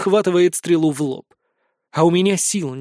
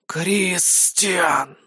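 drawn-out hissing snake voice; 3 dB quieter than usual. Acted. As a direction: stretch the name out like a serpent. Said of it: "протянула тварь змеиным голосом".

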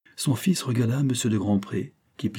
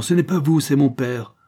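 harsh; 6 semitones higher, 6 dB louder than usual. Acted. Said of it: "fit-il durement".

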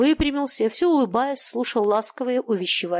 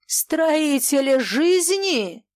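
high, very drawn-out jeer; jeering cry; 7 semitones higher, 3 dB louder, much slower than usual. A narrator's reading. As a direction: stretch the title out in scorn. Foma jeers at the merchants. (протяжно, с издёвкой)

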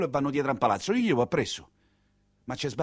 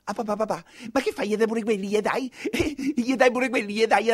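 put-on high, giggling protest of a young thug; a boy mocking his father for paying; high laughing whine; whining boy; 7 semitones higher than usual. Boy protests handing over money.

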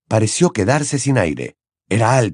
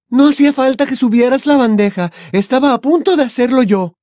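grumbling; 4 dB louder than usual. Acted. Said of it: "refunfuñó".

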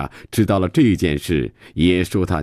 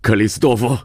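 raised, higher call of a name; 5 semitones higher, 3 dB louder, faster than usual. Acted. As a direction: call after someone who is leaving. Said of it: "却叫了声". (抬高声音叫住他)

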